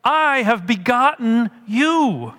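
slow down and lean on "I" and "you"; stress on I and YOU. Acted. Paul reminding Corinth who fathered them, emphatic "I" and "you". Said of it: "he emphasizes the I".

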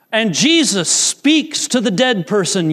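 normal talking voice

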